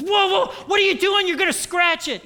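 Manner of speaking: high, loud panicked shout; mock panicked yell. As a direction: yelp like a boyfriend guarding his car. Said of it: "And he goes".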